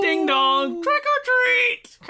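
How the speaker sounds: high, slow sing-song trick-or-treat call; spooky trick-or-treater. Pretend. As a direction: draw it out like a Halloween doorstep greeting.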